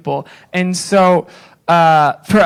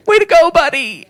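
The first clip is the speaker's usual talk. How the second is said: mock cheery praise; 10 semitones higher than usual. Easy empty praise.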